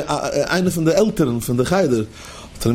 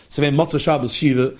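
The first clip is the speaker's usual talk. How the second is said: ordinary talk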